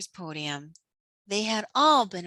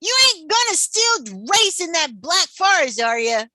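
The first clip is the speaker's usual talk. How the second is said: loud, high warning call; loud warning shout; yelling out; 8 semitones higher, 6 dB louder than usual. A narrator's reading. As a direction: yell across to the racers. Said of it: "Maynard yelled out".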